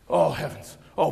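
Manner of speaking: ordinary delivery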